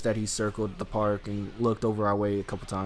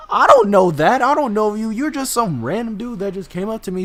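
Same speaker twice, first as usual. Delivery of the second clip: higher and louder, pushing back hard; firm loud refusal; 8 semitones higher, 7 dB louder than usual. Retold or imitated. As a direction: shut the stranger down. Refusing the stranger firmly.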